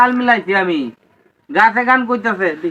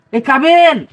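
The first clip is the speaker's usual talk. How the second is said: calling out; 4 semitones higher, 4 dB louder than usual. Acted. Calling out for someone.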